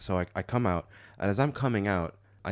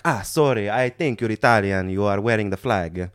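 put-on comic Italian-accented English; 3 dB louder than usual. Italian driver apologising to a stranger, comic Italian accent. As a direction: lay on a cartoon Italian accent. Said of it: "the comically stereotypical accent".

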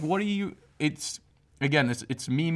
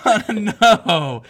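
higher, slow, loud through laughter; laughing echo; 8 semitones higher, 10 dB louder, much slower than usual. Acted. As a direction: echo it giggling. Echoes squeal laughing.

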